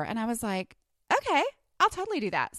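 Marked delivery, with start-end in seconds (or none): 1.1-2.6 eager. Retold or imitated. Retelling her eager reply.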